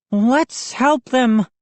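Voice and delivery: put-on high, slow boyish suggestion. A narrator's reading. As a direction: speak up timidly. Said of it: "finally finding his voice".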